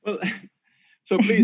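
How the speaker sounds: laughing start to an answer. Real, not acted. Guest laughing at the host's question, laughing. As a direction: chuckle through the first words.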